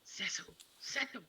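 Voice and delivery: urgent unvoiced whisper calling a name. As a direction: breathe the name twice, secretly. Secret whisper to husband.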